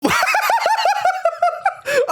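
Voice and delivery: long high-pitched laughing fit. Real, not acted. Reacting to the story's turn, a long high laugh. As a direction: dissolve into high giggles.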